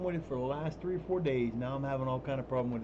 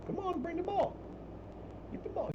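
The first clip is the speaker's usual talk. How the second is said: high-pitched pet coaxing; 4 dB quieter than usual. Real, not acted. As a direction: urge the cat on sweetly. Coaxing cat to fetch.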